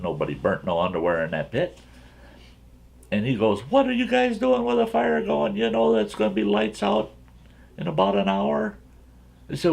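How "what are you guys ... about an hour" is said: higher-pitched, questioning camp counselor; imitated counselor, pitched well up. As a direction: put on a high voice for the counselor. Voicing the counselor questioning the boys.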